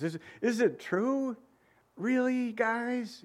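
high, slow incredulous asking; king incredulous at his own men, pitched high; disappointed king. Retold or imitated. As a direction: plead with them like a let-down friend. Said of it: "like he doesn't like the whole idea".

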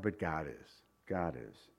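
low, soft and sure; softly insisting God is fair; quiet assurance. Acted. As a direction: drop the voice low to reassure. Sermon climax, quiet reassurance.

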